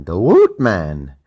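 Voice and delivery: loud, drawn-out sign-off name; hype announcement. Acted. Host announcing his own nickname, loud and stretched. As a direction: belt out your nickname. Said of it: "A K A the Woot Man".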